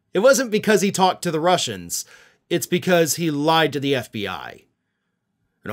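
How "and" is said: ordinary talk, nothing marked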